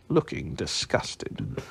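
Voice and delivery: ordinary delivery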